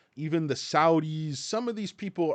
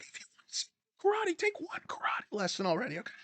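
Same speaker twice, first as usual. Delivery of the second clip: high, strained, hushed mock exasperation; Mac; 12 semitones higher, 5 dB quieter, faster than usual. Riffing on Mac from It's Always Sunny, exasperated. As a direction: do a squeaky, softened impatient character voice. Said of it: "It's like Mac and, like, It's Always Sunny".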